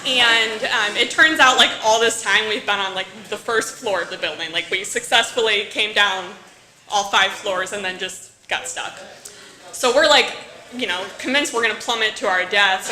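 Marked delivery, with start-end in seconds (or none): none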